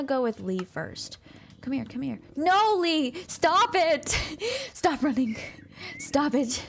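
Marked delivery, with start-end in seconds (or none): 2.3-6.6 high, loud scolding shout. Real, not acted. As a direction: yell at a runaway pet. Pet running away.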